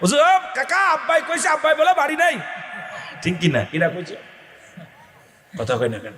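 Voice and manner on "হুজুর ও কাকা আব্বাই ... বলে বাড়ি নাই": put-on child calling out. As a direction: shout the excuse to the visitor.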